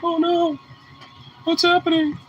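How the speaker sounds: high, squeaky voice for the startled dog; dog's inner voice. Retold or imitated. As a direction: play the dog, worried and high. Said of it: "He's like".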